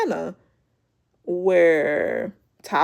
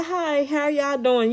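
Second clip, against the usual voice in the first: higher, slow, sugary hello to the room; sweet greeting; 7 semitones higher than usual. Retold or imitated. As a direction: stretch out a fake-friendly hello. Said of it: "She said".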